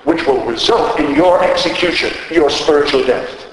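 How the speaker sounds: forceful pulpit delivery; powerful, emphatic. Retold or imitated. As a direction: drive each phrase home with rising pitch. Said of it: "in a powerful, emphatic way".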